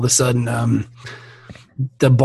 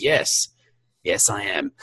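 low calm reply; 7 semitones lower, 7 dB quieter, slower than usual. Acted. Gunslinger answer, low.

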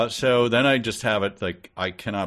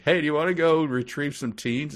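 lightly mimicked higher-pitched pitch to a friend; acted invitation; 7 semitones higher than usual. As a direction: casually pitch the errand, voice up.

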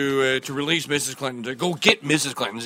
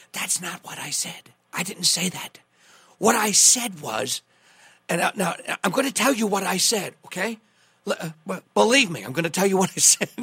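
Trump impersonation; 2 semitones higher than usual. Retold or imitated. Host mimics Trump brushing off a reporter, lightly voiced. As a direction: mimic Trump insisting he was misquoted. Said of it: "I'll do my best Trump, Trump impersonation".

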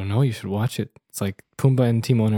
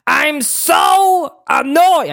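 high, drawn-out shouted comedian impression; Gilbert Gottfried; 13 semitones higher, 4 dB louder, much slower than usual. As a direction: squawk it slowly like an irritated parrot. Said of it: "let's not Gottfried up here".

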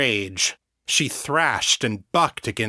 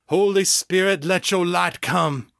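raised high proclamation; announced; 7 semitones higher than usual. A narrator's reading. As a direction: call out to the heavens, ringing. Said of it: "he announced".

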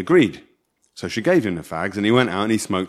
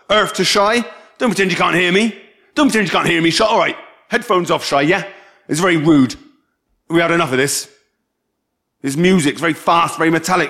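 raised, higher, insistent voice; exasperated calling; 6 semitones higher, 3 dB louder than usual. Acted. Telling Shai to take his headphones off, exasperated raised voice. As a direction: nag someone who has headphones on. Trying to get headphoned Shai's attention.

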